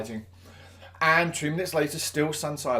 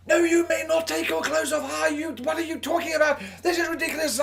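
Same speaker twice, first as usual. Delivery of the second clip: launching into a shout; 14 semitones higher than usual. Retold or imitated. Teacher to pupil asking to undress, outraged high shout. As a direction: blow up at him, much higher. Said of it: "And I just launched".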